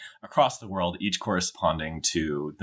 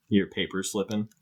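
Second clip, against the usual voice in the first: usual speaking voice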